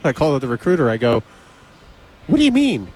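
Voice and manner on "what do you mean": high-pitched, baffled retold question; incredulous. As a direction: demand an explanation, voice shooting up. Acted startled call to recruiter.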